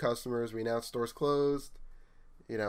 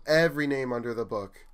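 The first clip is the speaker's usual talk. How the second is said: normal talking voice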